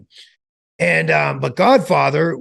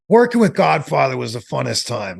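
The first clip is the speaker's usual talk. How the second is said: ordinary talk, nothing marked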